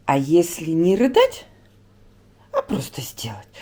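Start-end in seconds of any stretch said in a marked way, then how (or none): 2.5-3.5 whispering. Real, not acted. Coaxing crying son.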